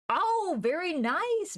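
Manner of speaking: high, slow drawn-out delight. Real, not acted. Streamer to chat after getting a group right, delighted. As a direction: stretch the oh up high, pleased. Reacting to a solved puzzle.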